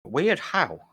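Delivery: posh voice with a lisp; bewildered gentleman with a lisp; fancy lisping voice. Acted. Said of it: "No, but you do sound fancier".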